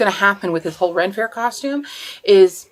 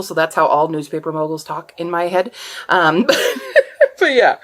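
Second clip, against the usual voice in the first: usual speaking voice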